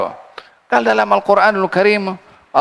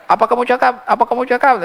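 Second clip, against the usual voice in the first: lightly acted, higher-pitched repeated question; imitated puzzled listener, raised pitch; Prophet asking; 7 semitones higher, faster than usual. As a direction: ask twice, pitched up, as if unable to hear. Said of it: "Sampai Nabi tanya".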